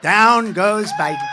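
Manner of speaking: slow, drawn-out ringside call; slow ringside knockdown call, laughing; mock knockout call. Shown as a demonstration. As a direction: call the knockdown like a boxing announcer. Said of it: "I go like this".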